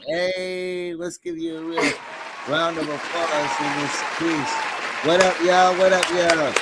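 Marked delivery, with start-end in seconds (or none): 5.1-6.5 shout-out. Real, not acted. Shout-out to viewers.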